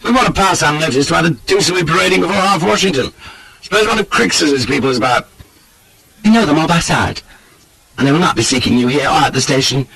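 high, rapid scolding woman; exasperated woman's voice, brisk; stern, impatient woman. A narrator's reading. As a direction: give curt orders, pitched high.

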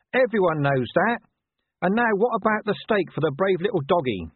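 a hint of a sly mutt angling for steak; cheeky talking dog. Hidden dog heckles crowd.

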